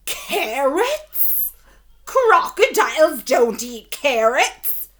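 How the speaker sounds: big booming crocodile, slow and loud. A narrator's reading. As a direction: bellow it like an offended crocodile. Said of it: "In a loud voice".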